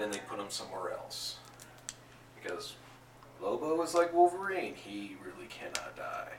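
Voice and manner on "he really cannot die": unvoiced secret whisper to the table; whispered aside. Real, not acted. Game master telling players a secret, whispered aside. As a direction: lean in and whisper it. Aside about Lobo's immortality.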